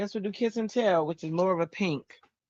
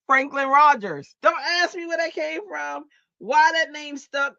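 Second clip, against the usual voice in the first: excited telling; 10 semitones higher, 6 dB louder than usual. Real, not acted. Amused retelling of uncle's nickname.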